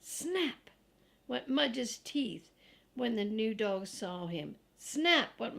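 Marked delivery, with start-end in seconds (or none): none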